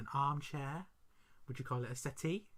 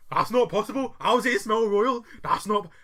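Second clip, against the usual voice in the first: put-on loud, higher, strong-accented protest; mock man-voiced child; 8 semitones higher, 12 dB louder than usual. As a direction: imitate an indignant boy who talks like a grown man. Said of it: "he's got the strongest man accent".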